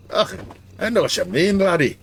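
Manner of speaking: playful mock-Scottish brogue; Scottish accent. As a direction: put on a hearty Scots dwarf. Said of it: "Only dwarves in fantasy games are allowed to have Scottish".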